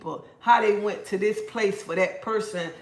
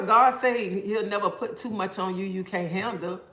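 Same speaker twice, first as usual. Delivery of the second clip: ordinary delivery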